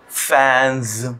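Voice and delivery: फैंस stretched slowly, ending on a buzzing Z; slow word demo. Shown as a demonstration. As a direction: draw out फैंस so the final Z is heard. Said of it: "N के बाद में". (फैंस को धीरे से, आख़िर में ज़ की आवाज़)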